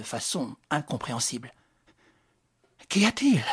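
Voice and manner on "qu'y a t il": worried. A narrator's reading. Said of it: "d'une voix inquiète".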